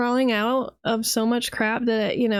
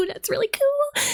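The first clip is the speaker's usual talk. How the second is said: mock-delighted with a laugh, pitched way up; sneering at the martyr idea, laughing high; sarcastic laugh; 14 semitones higher than usual. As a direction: laugh it out like a fake fan.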